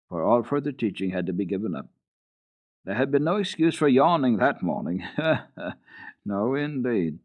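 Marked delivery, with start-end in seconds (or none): none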